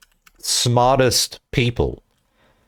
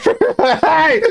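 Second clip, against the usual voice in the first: high, loud laughing yelp; laughing call-out; 4 dB louder, slower than usual. Real, not acted. Streamer interrupted mid-joke about roommate, laughing high yelp. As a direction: burst out laughing and call hey.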